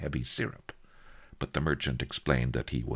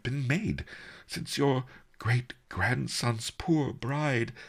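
higher, hesitant, stammering merchant; halting, deferential query; contrite; 10 semitones higher than usual. A narrator's reading. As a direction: broach the suspicion gingerly and apologetically. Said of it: "the merchant said contritely".

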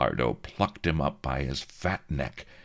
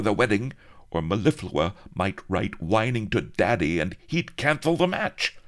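pathetic whimper; 9 semitones higher, 4 dB louder than usual. A narrator's reading. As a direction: whine like a spoiled child. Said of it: "He whimpered pathetically".

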